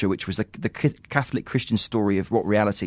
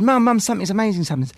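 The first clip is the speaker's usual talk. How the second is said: high, urgent retold plea to mum; imitating excited son; 9 semitones higher than usual. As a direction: act out a son bursting with news. Voicing son's excited news.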